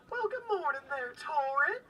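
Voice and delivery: high cheerful greeting. Acted. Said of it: "you see the head of Issa peek over".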